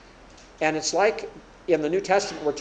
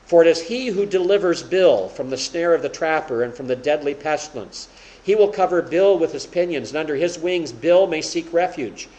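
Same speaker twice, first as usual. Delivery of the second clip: loud, ringing public reading with "Bill" in it; louder, declaiming scripture reading; reading scripture aloud; 2 semitones higher, 6 dB louder than usual. Shown as a demonstration. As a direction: proclaim the verse like a reader in church. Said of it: "if I were reading this".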